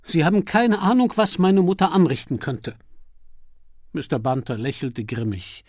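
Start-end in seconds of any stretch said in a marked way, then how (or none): 0.0-2.8 put-on higher, louder exasperated young lord; Lord Peter, exasperated. Lord Peter warns Bunter about his meddling mother, exasperated and raised. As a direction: throw up your hands about his mother.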